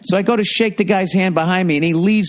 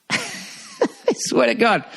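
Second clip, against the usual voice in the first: slow, laughing through the words; can barely get it out for laughing; 6 semitones higher, 4 dB quieter, much slower than usual. Real, not acted. Telling the Dodgers fan story, laughing through the words.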